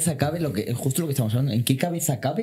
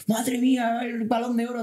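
mock fan praise; 8 semitones higher than usual. Bar crowd praising Vinicius.

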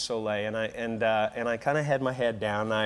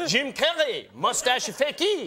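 high, drawn-out mock-foreign exclamation; imitated acrobat in broken English; 10 semitones higher, slower than usual. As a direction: call out like an astonished circus acrobat. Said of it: "The acrobats were stopping".

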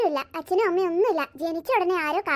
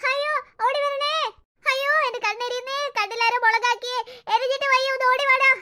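crying out in pain; 5 semitones higher, 3 dB louder than usual. Acted. Grandmother with chilli in her eyes calling family, agonised. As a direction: yell that your eyes are burning.

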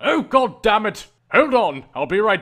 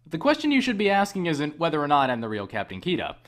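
usual speaking voice

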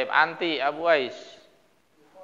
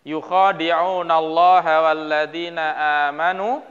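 measured, chanted Quran recitation; 5 dB louder, slower than usual. Acted. Teacher reciting the verse for the class in a slow chant.

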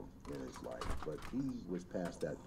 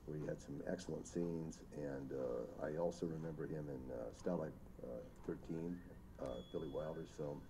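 usual speaking voice

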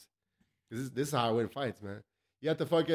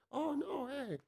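high, soft, breathy-pitched Anderson Silva imitation in a [name] accent; mock the fighter's [name] accent, way up high and hushed; much higher, softer [name] accent impression; 13 semitones higher, 6 dB quieter than usual. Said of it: "with his weird, eh, fucking [name] accent".

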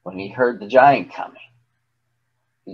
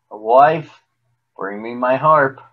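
plain reading voice

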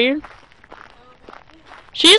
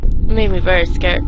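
usual speaking voice

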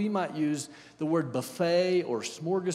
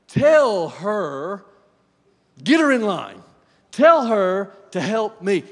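loud, insistent demand, slow on the last line; demanding Martha; 5 semitones higher, 15 dB louder, slower than usual. Acted. Martha demands Jesus make her sister help, loudly. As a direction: order him to set her sister straight.

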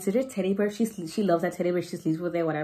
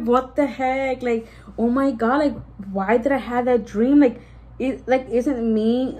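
higher-pitched, exasperated disbelief; retold bewilderment; 4 semitones higher, 4 dB louder than usual. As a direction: relive the shock of waking up. Retold shock on waking from nightmare.

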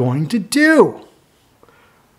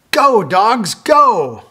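higher, louder cheer; cheering shout; 6 semitones higher, 4 dB louder than usual. Acted. Cheering the racing dogs on for the child. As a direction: urge the dogs on. Green light again.

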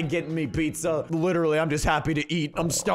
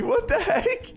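laughing disbelief. Real, not acted. Bidding war over adopting him.

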